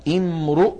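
"امرؤ" as "imru'", "u" after the r; second form, damma. Shown as a demonstration. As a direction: sound out "امرؤ" with ra rounded by damma. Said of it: "الثانية". (نطق «امرؤ» بضم الراء)

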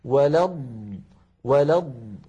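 "ولا الض" stretched on the closing dad; lengthened dad. Shown as a demonstration. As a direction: hold the dad sound ending "ولا الض". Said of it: "فيها مط وفيها تطويل". («ولا الض» بمطّ الضاد وتطويلها)

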